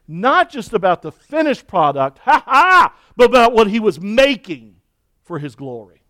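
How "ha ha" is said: real laugh. Real, not acted. Laugh heard mid-sermon.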